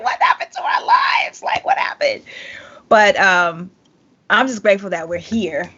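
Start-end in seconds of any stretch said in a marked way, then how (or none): 0.0-1.4 breathy whispered despair, pitch high. Retold or imitated. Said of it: "It was like". 1.4-2.2 soft low whispered question. Retold or imitated. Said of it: "It was like".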